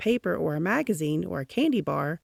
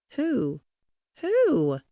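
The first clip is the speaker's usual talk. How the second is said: wondering aloud; 8 semitones higher than usual. A narrator's reading. Wondering who sent valentine.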